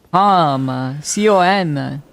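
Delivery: Com, then C O M spelled slowly, letter by letter; spelling it out. Shown as a demonstration.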